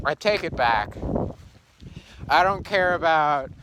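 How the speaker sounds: ordinary delivery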